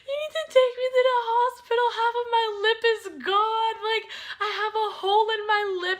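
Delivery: high, loud, fast panicked sobbing plea; retold crying. Crying girl begging her dad for the hospital, high and loud. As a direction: beg through tears like you're falling apart. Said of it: "I started bawling my eyes out".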